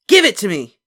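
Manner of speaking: put-on high, loud boy demand; angry demand. A narrator's reading. Boy yells for his sucker, angry. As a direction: grab for it shouting.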